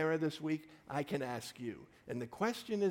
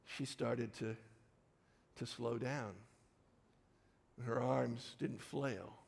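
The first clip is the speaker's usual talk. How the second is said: ordinary talk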